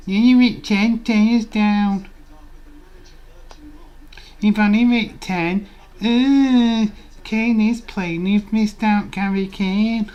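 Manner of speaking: high loud mocking whine imitating the chat; high mocking taunt at the streamer. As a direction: squeal it out like a gloating viewer.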